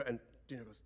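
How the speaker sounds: plain reading voice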